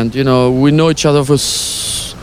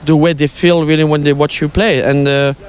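normal talking voice

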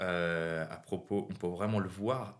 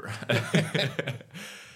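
laugh; 5 semitones higher than usual. Real, not acted. Laughing at the hangover joke.

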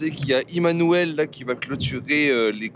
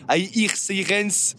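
French accent; 6 semitones higher, much slower than usual. Shown as a demonstration. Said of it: "je vais reprononcer avec l'accent français".

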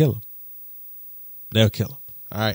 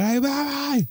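panicked call; 13 semitones higher than usual. Retold or imitated. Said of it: "I screamed to Bay".

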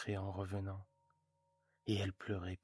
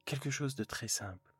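husband consoling his wife, louder; husband's voice; 3 semitones higher, 4 dB louder than usual. Acted. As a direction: coax her kindly, weighing the cost. Husband offering to help.

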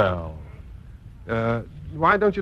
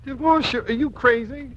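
indignant outburst; 7 semitones higher than usual. Acted. Husband to wife over divorce: incredulous, outraged. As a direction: throw the idea of divorce back at her, outraged.

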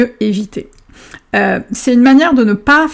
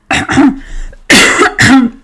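coughing fit; 4 semitones higher, 5 dB louder than usual. Real, not acted. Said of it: "Je m'étouffe".

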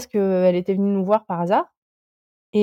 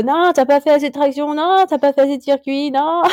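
higher-pitched mock nagging inner devil; little devil voice; 4 semitones higher than usual. Climber mimics the devil in everyone's head, mock nagging voice. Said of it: "ce petit diable dans la tête qui, qui nous dit".

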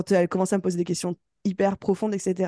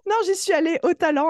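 laughing; 10 semitones higher, 4 dB louder than usual. Real, not acted. Jokes about winging it.

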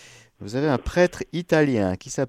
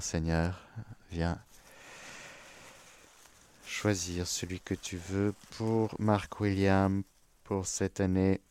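softer, hushed prayer to the Lord; quiet prayer; 4 semitones lower, 8 dB quieter, slower than usual. Acted. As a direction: pray it gently, almost to yourself. Prayer before drawing a saint. (prière douce et recueillie)

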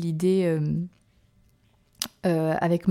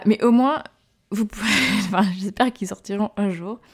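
normal talking voice

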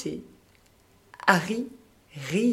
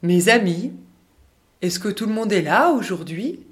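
louder calling worm voice; a bit louder; 3 semitones higher, 8 dB louder than usual. Acted. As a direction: raise the voice to be heard. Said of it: "Om parle cette fois un peu plus fort".

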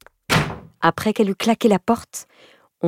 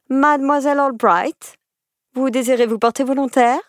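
teacher voice; 8 semitones higher than usual. Acted. Teacher addresses pupil.

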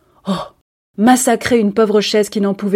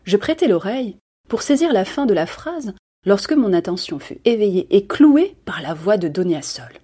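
usual speaking voice

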